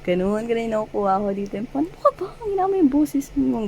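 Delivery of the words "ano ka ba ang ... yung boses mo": high-pitched playful scold; mock flustered scolding. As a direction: act shocked and shushing, half laughing. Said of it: "ganyan, no?".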